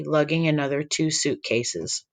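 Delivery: usual speaking voice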